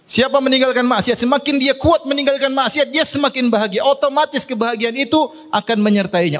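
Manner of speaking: high-pitched, insistent sermon delivery; emphatic preaching. Real, not acted. Preacher urges congregation to give up sin, emphatic preaching.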